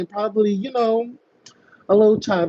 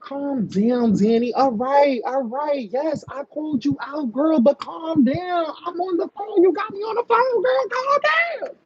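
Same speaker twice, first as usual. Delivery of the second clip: mock exasperated pleading; 8 semitones higher than usual. Host on phone with screaming caller, mock pleading that escalates. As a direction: pitch climbing from raised to squealing high.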